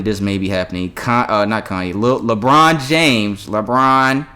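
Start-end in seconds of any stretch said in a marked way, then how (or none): 2.2-4.3 loud, stretched-out "LeBron James"; drawn-out name. Real, not acted. Host stressing LeBron James to his co-host, drawn out. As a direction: draw out "LeBron" and lean on it hard.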